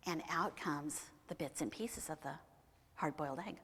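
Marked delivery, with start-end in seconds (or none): none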